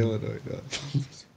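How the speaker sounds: whispered, breathy repeat; whispered echo. Pretend. Friend echoes the movie line in a whisper. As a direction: breathe the phrase back.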